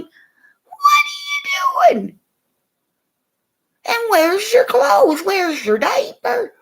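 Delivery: high, loud shocked parent yell. Retold or imitated. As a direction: yell at the nude kid in the bedroom. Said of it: "you're going".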